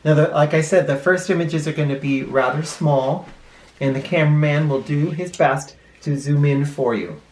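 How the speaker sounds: plain reading voice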